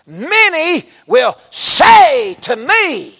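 loud, high, drawn-out proclamation; thundering scripture quote. Real, not acted. Preacher quoting Matthew seven to the church, thundering proclamation. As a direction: cry the verse out slowly, high and loud.

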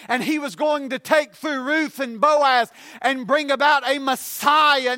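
emphatic, driving sermon delivery; full-force preaching. Real, not acted. Preacher telling congregation God saved Paul's life, full-force preaching.

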